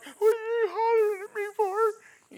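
put-on teenage son shouting back at his mother; kid yelling back. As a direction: holler back like an annoyed boy.

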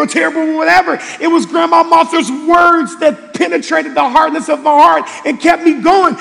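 loud, high, driving sermon voice; full-throated pastor's build; preaching at full force. Acted. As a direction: preach it out to the back row.

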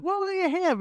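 a light send-up of a pub server asking; mock barmaid. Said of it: "she's like".

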